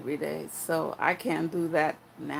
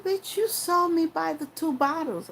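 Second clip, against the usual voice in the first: high, sassy comeback; sassy retort; 9 semitones higher than usual. Retold or imitated. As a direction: fire it back with attitude. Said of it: "I didn't say bitch".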